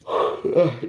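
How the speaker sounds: long tired sigh; weary sigh. Real, not acted. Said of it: "Don't mind me".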